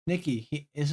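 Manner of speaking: ordinary talk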